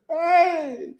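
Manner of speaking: can't hold back a laugh; real laugh. Real, not acted. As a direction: burst of laughter, high exclamation. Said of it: "I shouldn't be laughing".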